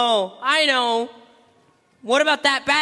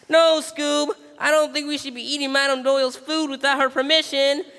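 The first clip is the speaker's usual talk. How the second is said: ordinary talk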